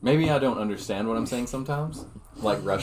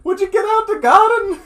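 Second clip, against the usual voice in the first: much higher, louder scolding granny; Irish granny; 8 dB louder than usual. Pretend. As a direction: shoo kids out like an Irish granny.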